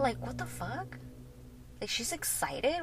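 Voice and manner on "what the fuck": hushed, higher-pitched disbelief; soft retold bewilderment; tripping out. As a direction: mutter it under your breath, baffled. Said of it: "I'm tripping out".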